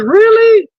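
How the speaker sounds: very high, stretched-out mock surprise; mock flattered surprise. Women hearing a compliment from a stranger, mock flattered surprise. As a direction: squeal it like an imitation of flattered women.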